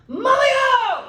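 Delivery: high, loud yell of a nickname; big high-pitched impression of a yeller; imitated yelling. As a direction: holler it like the excitable tour manager. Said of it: "it's more of a yelling".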